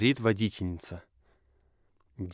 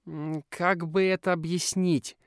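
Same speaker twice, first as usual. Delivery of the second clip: hesitant; 9 semitones higher than usual. A narrator's reading. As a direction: stall for time, unsure. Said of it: "тянет с ответом Мо".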